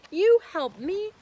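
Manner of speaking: loud, high incredulous scoff; mock the idea, disbelieving; scoffing lion. Lion scoffing at the mouse's promise, loud disbelief. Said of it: "laughed the lion".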